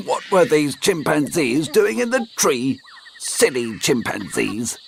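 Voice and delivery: the grumpy dog muttering to himself. Acted. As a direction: sniff at the silly chimps.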